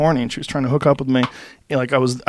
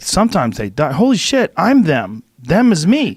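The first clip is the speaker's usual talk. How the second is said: panicked realization; 5 semitones higher, 4 dB louder than usual. Retold or imitated. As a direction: voice climbing high and loud in alarm. Panicked realization, rising excitement.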